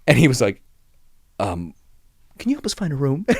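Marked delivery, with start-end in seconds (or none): 1.4-3.2 higher, meek little request; mock timid guest. Cowed guest asking the front desk agent, meek. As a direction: imitate someone suddenly cowed. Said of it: "when somebody's soul leaves their body".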